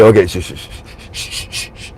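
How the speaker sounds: fading to soft unvoiced shushes; hushing shh. Wall Street hushing in reply, fading to soft shushes. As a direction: shush them down to nothing.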